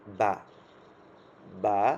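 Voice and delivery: বা said loud with both lips pressed; teacher modelling a strong বা; ba demo. Shown as a demonstration. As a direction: pop বা off closed lips. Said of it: "দুইটা ঠোঁট একসাথে লাগাবেন".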